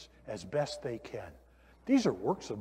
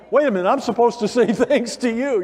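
high and loud, laughing through the words; laughing quote; 7 semitones higher, 7 dB louder than usual. Retold or imitated. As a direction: play your own surprise, chuckling mid-line. Imitates his own thought, laughing.

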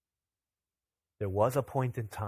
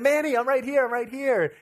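high, loud reassuring call; urgent calling out; 13 semitones higher, 7 dB louder than usual. Retold or imitated. Dad to crying daughter from hiding, urgent call. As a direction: call out urgently to a panicking child.

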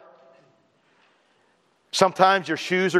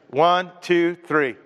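usual speaking voice

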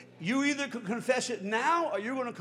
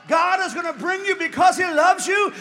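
high, loud, driving preacher build; preaching at full force; 6 semitones higher, 8 dB louder than usual. Real, not acted. Preacher pressing the crowd toward surrender, full force. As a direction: rise and hammer it home.

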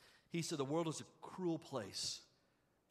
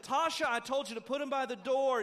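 louder mock scold at a child; scolding parent; 9 semitones higher, 8 dB louder than usual. Mother scolding Tasha about her shoes by the door, loud. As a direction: bark it like a fed-up mom.

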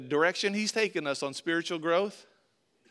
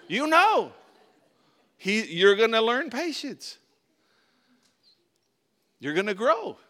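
high and loud, slower; loud preaching; 5 semitones higher, 8 dB louder than usual. Real, not acted. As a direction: proclaim it to the room. Preaching at full force.

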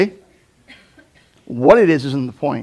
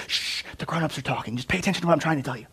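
soft, fast grown-up brush-off; Moses hushing; 2 semitones higher, 6 dB quieter, faster than usual. Retold or imitated. Moses hushing the skeptic, soft and quick. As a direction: shush a kid who interrupts. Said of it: "Moses would say".

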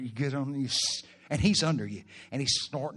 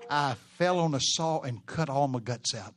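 ordinary talk, nothing marked

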